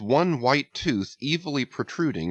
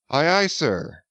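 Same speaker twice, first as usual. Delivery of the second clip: higher, louder cheerful answer; cheerful cry; 7 semitones higher, 3 dB louder than usual. A narrator's reading. Little mate answering his captain, cheerful cry. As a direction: sing out the reply gladly. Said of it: "cheerfully cried little King Post".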